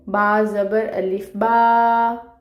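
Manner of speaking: बा stretched over two beats, slowly; two-beat madd. Shown as a demonstration. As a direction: draw out the vowel in बा. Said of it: "दो हरकत लंबा पढ़ेंगे".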